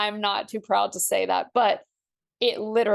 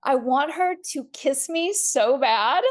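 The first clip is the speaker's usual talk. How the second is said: higher, doting dog-lover gush; 5 semitones higher than usual. Retold or imitated. Admitting she now loves dog kisses, gushing higher. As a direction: swoon over the dog. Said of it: "And now I'm like".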